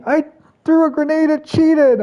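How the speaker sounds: very high, loud teasing voice; teammate's confession. Retold or imitated. Mimicking Supra boasting about the grenade, high and loud. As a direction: chirp it like you're proud of it. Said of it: "Supra's like".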